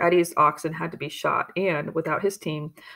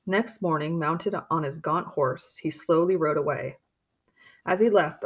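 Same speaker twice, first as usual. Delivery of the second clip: plain reading voice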